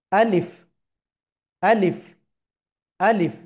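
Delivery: a crisp model আলিফ; correct recitation model. Shown as a demonstration. Teacher recites the letters for the class, আলিফ short and exact. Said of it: "আপনাকে আমি সঠিকভাবে একবার".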